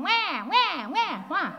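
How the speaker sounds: put-on loud, high "wah wah" baby wail; mock baby crying. Preacher imitating a crying baby for the congregation, loud wail. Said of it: "they're going".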